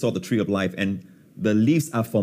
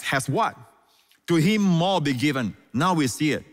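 ordinary talk

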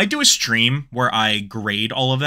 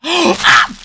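high squealing cries of delight, much higher and louder; excited squeal; 6 dB louder, much slower than usual. Real, not acted. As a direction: squeal over the new pin. Unboxing her own pin design.

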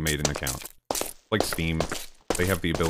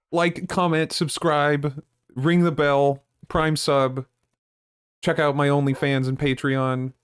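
high, loud hype-man call-out; mock streamer plug; 9 semitones higher, 6 dB louder, slower than usual. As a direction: belt out the sign-off like an over-eager streamer. Mock streamer plug, rattled off loudly.

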